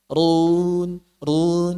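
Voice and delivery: "run" held long on one tone; thick ro, thin long vowel. Shown as a demonstration. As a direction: try "run" with a heavy r and a light, drawn-out u. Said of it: "Roknya tebal. Madnya tipis, itu susah".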